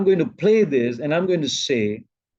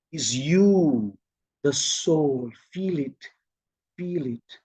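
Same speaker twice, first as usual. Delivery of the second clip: hushed, spaced-out phrases fading away; guided meditation; 3 semitones lower, 3 dB quieter, slower than usual. Shown as a demonstration. Teacher guiding them to feel the soul, softly fading. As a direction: let FEEL IT sink softer, with pauses. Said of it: "Then I will say".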